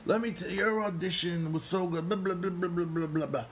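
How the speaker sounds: high, gushing fan voice with a rush of praise; gushing excitement. Retold or imitated. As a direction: imitate an overjoyed director raving about the audition. And he's like, Jason gushing.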